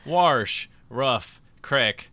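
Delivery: loud, separate words, "Warsh" with an R, "crick" with short i; mimicked regional words. Teasing friend's odd pronunciations, loud mimicry. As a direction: punch each mimicked dialect word on its own. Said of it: "list of words he's gonna say".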